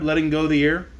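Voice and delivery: plain reading voice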